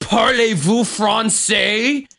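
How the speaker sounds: high, drawn-out mock drunk challenge; Kimmy. Drunk woman challenging bandmate in French, mocking imitation. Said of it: "she's like".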